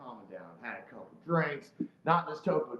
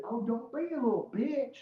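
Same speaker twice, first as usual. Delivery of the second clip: higher-pitched mocking mom; put-on high jeering voice; taunting mother; 9 semitones higher than usual. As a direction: imitate her needling the kids. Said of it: "she was, like, calling her kids".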